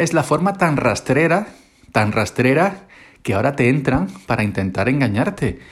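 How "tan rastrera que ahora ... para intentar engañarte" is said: ordinary talk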